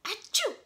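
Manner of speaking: a high, loud acted sneeze; hammed-up storybook sneeze. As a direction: sneeze it out big. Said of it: "startled by a loud".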